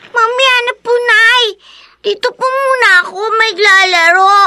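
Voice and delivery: put-on high, whiny child's plea; pleading child. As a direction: beg to stay out and play. Child begs to keep playing. (nakikiusap na boses ng bata)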